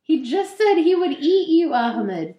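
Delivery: exasperated. Real, not acted. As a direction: scold the hero in disbelief. Exasperated at the hero.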